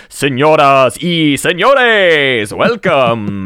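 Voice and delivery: slow booming ring announcer call; luchador announcer. Retold or imitated. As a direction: hype the crowd like a wrestling announcer. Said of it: "a sort of character voice of a luchador announcer".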